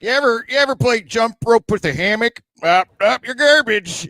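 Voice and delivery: high, loud mocking podcast-host voice; Are You Garbage host impression. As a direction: shout it like the show's host. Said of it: "You're like".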